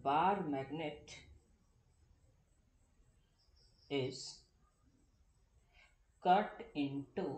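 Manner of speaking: each word of "bar magnet is cut into two equal half" spaced out for copying; drawn-out dictation with long gaps between words; very slow dictation. Shown as a demonstration. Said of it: "मैंने ऐसा देखा था". (हर शब्द रुक-रुक कर लिखवाना)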